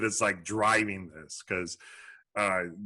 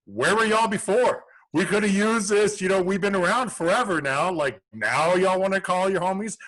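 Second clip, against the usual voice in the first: higher, louder playful scolding; mock indignant rant; 9 semitones higher, 6 dB louder than usual. Co-host ribbing new followers, loud mock indignation. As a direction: call them out, loud and teasing.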